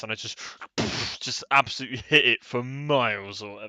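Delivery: normal talking voice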